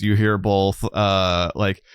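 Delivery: usual speaking voice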